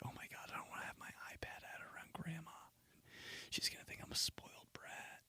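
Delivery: whisper. Retold or imitated. Hiding iPad from grandma.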